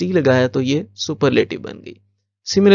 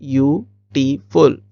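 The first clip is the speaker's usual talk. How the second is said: slow, split into separate syllables; much slower than usual. Shown as a demonstration. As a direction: break बी यू टी फुल into slow, separate sounds. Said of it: "इसमें बहुत सारी sounds हैं".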